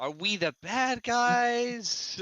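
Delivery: slow dawning realization. Acted. Realizing joining bad guys.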